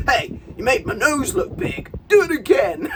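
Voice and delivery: high, loud, bratty complaint; a hammed-up demanding whine; entitled customer. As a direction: play a spoilt customer sending it back. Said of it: "son of a bitch who's gonna go".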